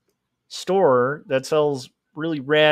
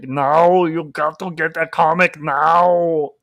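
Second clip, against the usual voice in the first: put-on slow Austrian-accented action-hero voice; Arnold Schwarzenegger impression; 5 dB louder, slower than usual. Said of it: "Arnold Schwarzenegger kind of thing".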